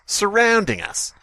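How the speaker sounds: ordinary delivery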